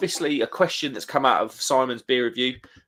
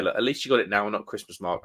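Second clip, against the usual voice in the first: normal talking voice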